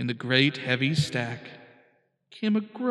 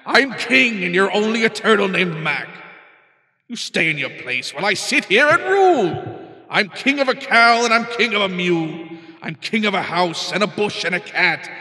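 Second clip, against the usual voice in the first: higher, louder royal boasting, quicker in places; boastful king; 5 semitones higher, 8 dB louder than usual. Acted. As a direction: crow it over the little turtle. Boastful king brags to turtle.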